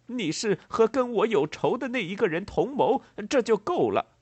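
loud, high accusatory tirade; acted furious threats; angry. A narrator's reading. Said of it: "愤怒的布瑞可南说道".

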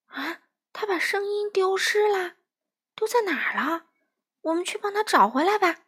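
high-pitched, lightly acted alarm; anxious. A narrator's reading. Duck hears Frog has lost his voice, anxious. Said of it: "小鸭着急地说".

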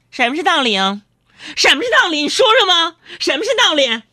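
high, loud nagging. Retold or imitated. Imitating his wife Sister Yang shouting back at him, high. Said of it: "那家伙他嗓门更高了".

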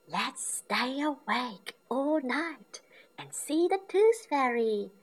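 excited whispery plan to stay up; Peppa plotting. A narrator's reading. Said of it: "says Peppa".

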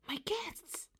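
high, eager little exclamation; excited cry. A narrator's reading. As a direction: cry out as the guests arrive. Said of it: "cried D W".

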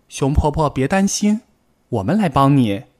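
a small rabbit eager to help; eager little rabbit. Acted. Little rabbit to Grandma Bear, eagerly offering help.

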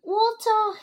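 high, loud desperate cry; urgent choking plea, acted; gasped. A narrator's reading. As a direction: gasp out for help. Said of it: "he gasped".

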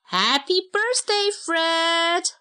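high, bright birthday cheer; cheerful cry. Acted. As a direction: shout it out for the birthday boy. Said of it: "cried his family".

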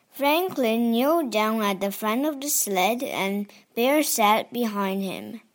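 ordinary delivery

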